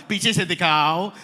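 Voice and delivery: slow, loud South Mumbai Hindi. Acted. Host imitating mother's orders, South Mumbai Hindi. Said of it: "that's what my mother says".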